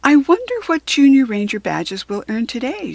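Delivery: a keen child hoping for a badge; excited young girl voice; eager girl. Rachel voiced in story.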